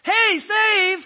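high, loud plea for rescue; desperate cry for help. Shown as a demonstration. Preacher demos a desperate plea, loud and high. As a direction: cry it out like you're drowning. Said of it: "One was a cry for help".

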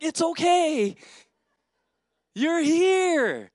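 very high, loud holler; acted shout to someone who can't hear; shouted reassurance. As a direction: holler comfort across the room. His shouted answer to Thelma.

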